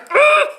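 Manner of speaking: high, loud groan of frustration; exasperated ugh. Retold or imitated. Venting to viewers about people walking in the background, exasperated groan. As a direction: burst out with a strained "ugh". Imagined outburst at pet peeve.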